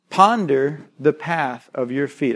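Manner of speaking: slow, weighty "Ponder the path of your feet"; slow scripture reading. Shown as a demonstration. Pastor reading Proverbs slowly to his church. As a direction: read it slowly so each word lands. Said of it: "Underline the word feet".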